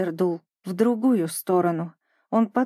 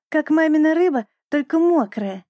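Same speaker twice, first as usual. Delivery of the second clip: higher-pitched, lightly voiced chick; pleased little penguin; 7 semitones higher, 3 dB louder than usual. A narrator's reading. Little penguin tasting ice for himself, pleased. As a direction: lift it up like a curious youngster.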